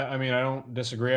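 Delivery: ordinary talk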